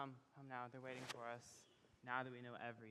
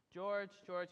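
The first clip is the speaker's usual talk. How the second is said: calling out; 6 semitones higher, 10 dB louder than usual. Acted. Father calling the groom over.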